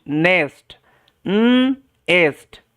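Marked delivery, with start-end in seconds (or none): 1.3-2.6 "উম এস্ট" as long nnn, gap, then est; sounding out. Shown as a demonstration. Teacher modelling nest for learners, sounded out slowly. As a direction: slowly split nest after a held n. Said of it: "করবেন কিন্তু".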